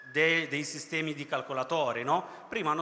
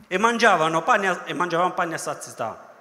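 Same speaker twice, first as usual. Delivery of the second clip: loud mock complaint; 3 semitones higher, 8 dB louder than usual. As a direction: belt it out like a spoiled crowd. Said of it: "quoting grumbling Israelites".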